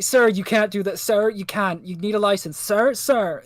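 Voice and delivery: lightly voiced cop calling after a rider; urgent mock police protest; police officer calling.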